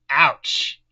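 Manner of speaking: mock ouch. Reader's mock pained reaction.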